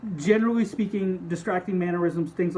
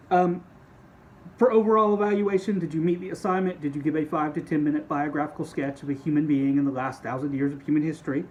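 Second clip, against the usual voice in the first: ordinary talk, nothing marked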